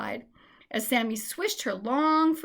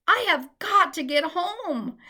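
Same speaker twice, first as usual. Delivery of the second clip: crying out; 7 semitones higher, 5 dB louder than usual. A narrator's reading.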